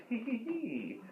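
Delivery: high, soft villainous tee-hee; sneaky acted giggle; evil giggle. A narrator's reading. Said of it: "that laugh, that giggle".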